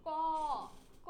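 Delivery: ক with its final অ held long; drawn-out vowel. Shown as a demonstration. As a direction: stretch ক out into a long, sustained "ko-o-o". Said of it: "শুধু ক বলে আমরা থেকে যাই না". (ক-এর অ টেনে লম্বা করে)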